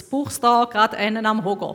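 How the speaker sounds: ordinary talk, nothing marked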